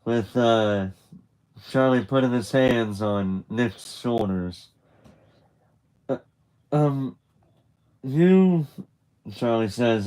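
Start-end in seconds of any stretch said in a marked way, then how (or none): 6.1-8.7 stuttering. Retold or imitated. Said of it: "a little st-, stuttering".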